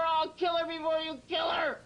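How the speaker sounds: fast furious shout; shouted threat. Real, not acted. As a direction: yell the threat. Violent alter Enigma repeats threat.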